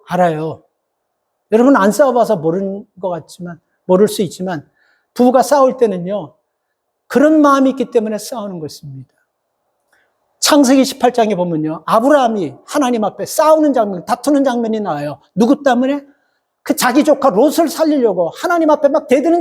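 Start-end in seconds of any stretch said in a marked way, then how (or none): none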